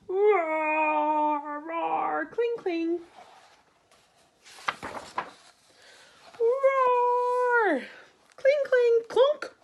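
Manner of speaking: battle roars and clangs. Acted. Battle raging as Boy runs in, roars and clangs building. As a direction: build the fight up to a loud climax.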